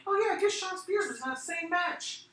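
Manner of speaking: high mocking voice of a forgetful fan; mock indifferent fan. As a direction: play a shrugging fan who barely remembers him. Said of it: "people are all like".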